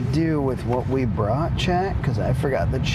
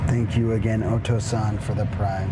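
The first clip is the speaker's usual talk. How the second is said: calm thanks; 7 semitones lower than usual. Real, not acted. Repeat thanks, low and soft.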